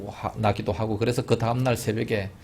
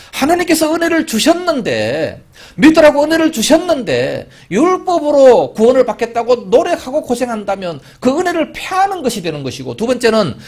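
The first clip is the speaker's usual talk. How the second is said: high, loud, impassioned sermon climb; full-force preaching; 12 semitones higher, 9 dB louder than usual. Acted. Pastor pressing the congregation on grace, loud and high. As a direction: drive the point home to the pews.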